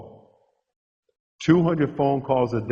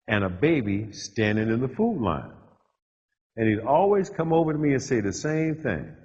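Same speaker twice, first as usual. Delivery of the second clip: ordinary talk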